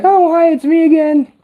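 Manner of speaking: mock high fake voice. Mocking patients waking from surgery, high fake voice. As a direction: chirp it like a put-on girly voice. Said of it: "they're like".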